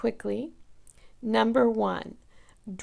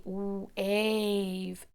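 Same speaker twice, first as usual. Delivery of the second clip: ordinary delivery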